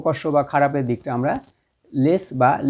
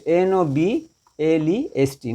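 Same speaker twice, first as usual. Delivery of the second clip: slow, even letter-by-letter dictation; spelling out; much slower than usual. Shown as a demonstration. Teacher spells the superlative for students, slowly letter by letter.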